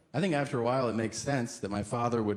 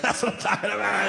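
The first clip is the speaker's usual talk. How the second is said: put-on gleeful toddler; 7 semitones higher, 5 dB louder, faster than usual. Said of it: "he's like".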